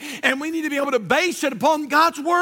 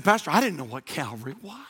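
low, soft retold admission; hushed confession; 10 semitones lower, 7 dB quieter, faster than usual. Lady admits her confusion to the pastor, hushed low confession. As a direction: confess it softly, pitched well down.

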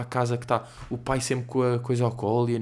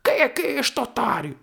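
high put-on kid voice, scornful; high mocking voice of a resentful older sibling; mock jealous sister; 13 semitones higher than usual. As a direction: squeak it out like a jealous big sister. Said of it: "não ficou tipo".

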